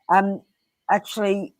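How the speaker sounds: usual speaking voice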